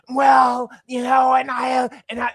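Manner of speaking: loud, hammed-up rasp like sandpaper on the throat; mock raspy lawyer. Mocking the raspy Trump lawyer with a loud, gritty croak. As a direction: croak it out like a lawyer with a Big Mac stuck in his voice.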